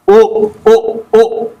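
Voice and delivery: each O a quick "oo" as in cook; short vowel. Shown as a demonstration. Teacher drilling the short vowel sound, short O.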